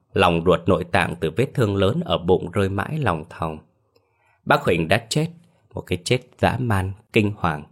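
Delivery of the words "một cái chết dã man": ordinary delivery, nothing marked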